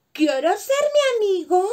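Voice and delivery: calling up high and loud; shouting up. A narrator's reading. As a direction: yell the question up to a tall giraffe. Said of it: "le preguntó gritando".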